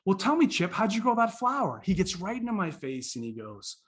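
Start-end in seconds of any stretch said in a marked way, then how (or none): 0.0-1.8 put-on higher, animated questioning of a child; eager questioning. Visitor asking Chip about the flower, eager. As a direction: coax the answer out with lively interest.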